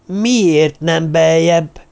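slow, drawn-out screech, mildly acted. A narrator's reading. Törpapa asking the others about the burial, screeching. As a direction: screech each word out in exasperation. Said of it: "rikácsolta Törpapa".

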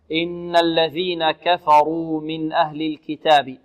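normal talking voice

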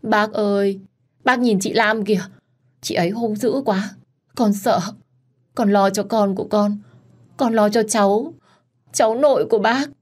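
acted whimpering complaint; tearful pleading. A narrator's reading. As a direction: plead like a frightened, wronged girl. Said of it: "tiếp tục khóc lóc".

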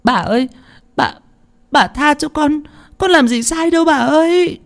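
put-on very high, agonised child's plea; pained, halting wail; stammering in pain. A narrator's reading. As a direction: cry out for mercy, stumbling over the words. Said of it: "hét lên đau đớn lắp bắp".

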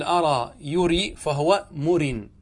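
slow أرى يري, long vowels, no catch; forms without hamza. Shown as a demonstration. Teacher runs through the derivation slowly, no hamza. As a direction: stretch يري and end on murin in مرن. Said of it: "تقول".

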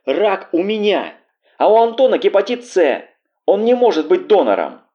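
high, furious cry; angry onslaught. A narrator's reading. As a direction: throw the words at her in a high angry voice. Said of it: "под таким напором её злости". (злой высокий крик, с напором)

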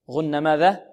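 normal talking voice

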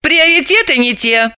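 high-voiced heroine, businesslike; matter-of-fact. A narrator's reading. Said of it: "практично сообщила Далила".

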